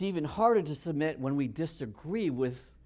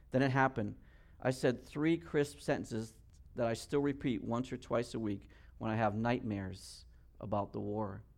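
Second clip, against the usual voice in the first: hushed, slowing narration, dropping away at the end; grave story climax; 4 semitones lower, 5 dB quieter than usual. A narrator's reading. Soldier confessing the killing in his account, grave and hushed. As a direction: let it sink low and soft, line by line.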